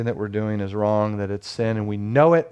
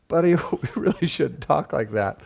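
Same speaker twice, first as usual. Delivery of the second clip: laughing reproof; 3 semitones higher than usual. Retold or imitated. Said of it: "And I'm like".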